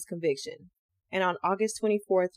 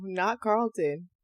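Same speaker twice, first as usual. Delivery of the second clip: normal talking voice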